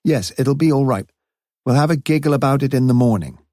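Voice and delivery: a light imitation of the young girl; quoting the girl. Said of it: "she kept saying something like".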